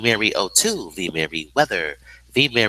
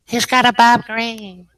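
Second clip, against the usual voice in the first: high, louder character echo; put-on high voice; 14 semitones higher, 5 dB louder than usual. Echoing the Bob Green line, high character voice.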